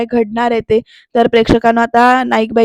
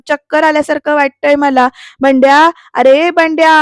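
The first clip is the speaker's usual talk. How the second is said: acted holler building on the name; calling out loudly; 3 semitones higher, 5 dB louder than usual. Dizzy mother yelling for her son, calling out loudly. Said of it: "असं जोरजोरात ओरडा, आरडाओरडा सुरू आहे".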